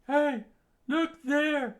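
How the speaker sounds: high, loud call. Acted. Soldier calling the others to look. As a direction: point and shout.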